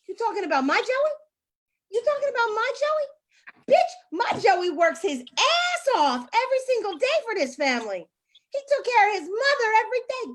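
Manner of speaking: high, loud indignant shouting; outraged wife. Acted. Wife shouting at a stranger who insulted her Joey, outraged. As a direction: rise up in outrage defending him. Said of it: "Angela will be like".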